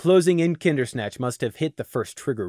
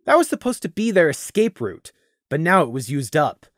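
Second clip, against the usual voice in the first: usual speaking voice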